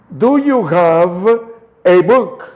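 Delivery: slow, careful English question; slow demo. Shown as a demonstration. Teacher modelling the question slowly for students. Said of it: "Chequen cómo va el orden de la pronunciación".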